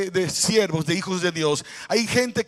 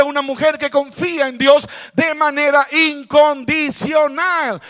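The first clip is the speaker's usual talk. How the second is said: louder, higher preaching climax; fiery preaching; 5 semitones higher, 6 dB louder than usual. Acted. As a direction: build to a full-force sermon shout.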